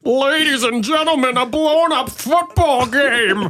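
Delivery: high, drawn-out announcer cry; hammed-up big show introduction; Don Pardo. Announcer presents host to ladies and gentlemen, grand voice. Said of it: "I'm Don Pardo".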